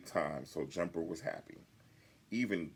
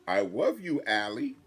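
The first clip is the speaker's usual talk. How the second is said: loud and bright; 4 semitones higher, 9 dB louder than usual. A narrator's reading.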